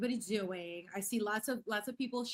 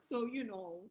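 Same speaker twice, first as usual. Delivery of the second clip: ordinary talk, nothing marked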